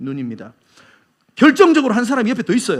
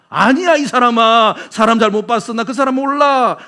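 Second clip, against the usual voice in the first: protesting denial; 3 semitones higher, 5 dB louder than usual. Acted. Peter insisting he doesn't know him, protesting. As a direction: wave it off loudly, dragging the words.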